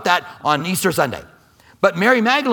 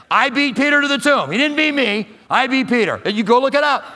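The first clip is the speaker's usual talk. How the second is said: high, bragging, competitive; mock boast about beating Peter; boastful John; 8 semitones higher than usual. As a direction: crow it like a proud winner. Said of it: "John wants you to know, 'cause we're so competitive".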